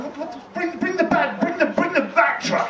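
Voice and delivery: high, pushy repeated demand; indignant demand. Acted. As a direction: insist like a spoiled boss. Bruce demanding the bat truck.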